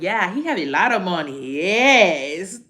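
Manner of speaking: mocking coworker. Mocking the other girl gushing over her ex. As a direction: gush about his money in a put-on girly voice. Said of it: "the other girl like".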